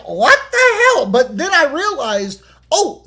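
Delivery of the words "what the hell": high shocked outburst. Retold or imitated. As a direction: blurt it out in disbelief. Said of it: "And I was like".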